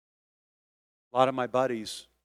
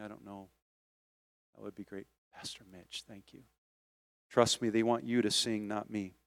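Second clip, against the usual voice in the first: ordinary delivery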